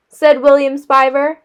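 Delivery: ordinary delivery, nothing marked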